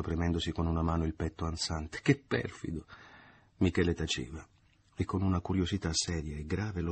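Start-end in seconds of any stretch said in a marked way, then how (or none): 2.0-2.8 higher falsetto repeat. A narrator's reading. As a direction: repeat the coy reproach in falsetto. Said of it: "ella ripeté in falsetto".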